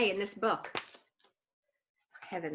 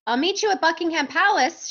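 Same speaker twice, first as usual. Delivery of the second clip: high, raised calling voice; shouted; 8 semitones higher, 4 dB louder than usual. A narrator's reading. Duck calls to Dodsworth from moving bus, shouted. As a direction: call out from the departing bus. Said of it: "shouted the duck".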